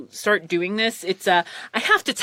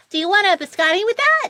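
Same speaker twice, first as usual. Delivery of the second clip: higher put-on barista voice; mock cashier upsell; 12 semitones higher, 3 dB louder, faster than usual. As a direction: offer it in her striking voice. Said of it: "And you're like".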